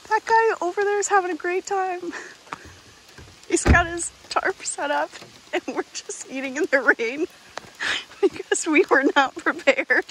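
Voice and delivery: real giggles breaking through high-pitched words; can't stop laughing. Real, not acted. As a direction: talk through helpless laughter. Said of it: "I can't stop laughing".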